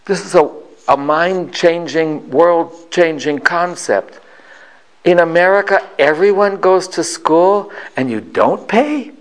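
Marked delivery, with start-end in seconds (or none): 5.0-9.1 imitating grandmother's incredulous wonder; amazed disbelief. Grandmother amazed at mind-changing concept.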